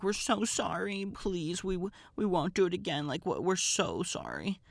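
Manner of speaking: high-pitched imitation of begging; pleading apology. Retold pleading with the guards.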